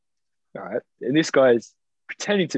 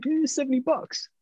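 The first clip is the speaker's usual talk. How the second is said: mock casual quote. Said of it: "He's like".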